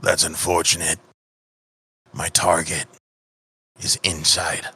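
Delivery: low, cold killer voice; a quiet threat from a hired killer; menacing assassin. Acted. Assassin refusing the player's offer, low and cold.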